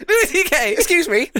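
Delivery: high, loud mock outrage; hammed-up scandalised protest; offended woman. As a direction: squeal it in put-on indignation. Acts out outraged woman in sketch.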